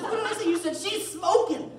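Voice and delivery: very high, loud indignant yell; mock outrage. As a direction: shriek the accusation back, hammed up. Acted quarrel with partner.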